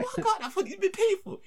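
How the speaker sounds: high-pitched, laughing through it; squeaky laughing outrage; laughing disbelief. Retold or imitated. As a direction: crack up at the shock. Shocked at the bar bill.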